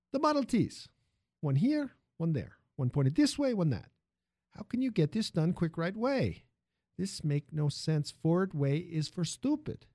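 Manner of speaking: put-on animated foreign-accented factory hand, slowing at the end; lilting accent, pitch jumping high; opinionated immigrant worker. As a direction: grumble about the system in immigrant English. Armando sermonizes on factory method.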